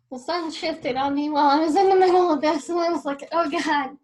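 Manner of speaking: loud, exasperated at the glaring sun; loud exasperated outburst. Real, not acted. Tells viewers the sun shifted, loud and exasperated. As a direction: burst out in frustration.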